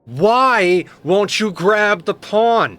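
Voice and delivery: high, loud, slow drawn-out plea; exasperated yell. Real, not acted. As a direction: stretch it out in disbelief at the pawn. Yelling at game pawn.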